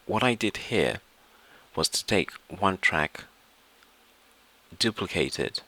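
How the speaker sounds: ordinary talk, nothing marked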